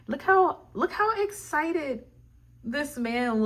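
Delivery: high, bursting with delight at the card; excited. Real, not acted. Reader gushing to viewers over the happy card, excited.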